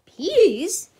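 loud rising disbelief; shocked question; exclaiming. A narrator's reading. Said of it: "exclaimed Skipito".

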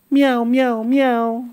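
high mewing kitten calls; high little kitten meows in a row. Acted. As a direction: mew like a tiny kitten.

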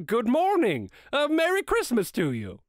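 high, hearty passer-by's call; good-humoured greeting. A narrator's reading. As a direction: sing out the greeting cheerfully to a stranger. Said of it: "three or four good-humoured fellows said".

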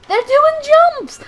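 high, loud, slow squeal; delighted shriek; excited squeal. Real, not acted. Excited at Pokemon jumping.

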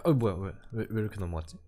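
ordinary talk, nothing marked

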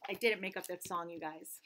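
ordinary delivery, nothing marked

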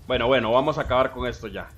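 usual speaking voice

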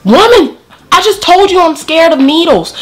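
high, indignant retort; exasperated. Retold or imitated. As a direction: scold her for not listening.

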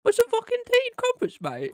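high, loud mock British accent. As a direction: shout it like a cartoon Brit.

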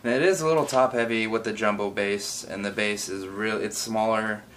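ordinary talk

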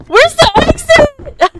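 slow high panicked cry; fret, lost in the dark; scared horror game streamer. Real, not acted. Lost while chased.

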